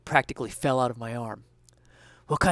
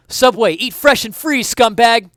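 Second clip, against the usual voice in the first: high, loud shouted cop order; yelling; 9 semitones higher, 10 dB louder, slower than usual. Acted. Detective yells after the fleeing man, high and loud. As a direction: yell it after a fleeing suspect. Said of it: "I yelled".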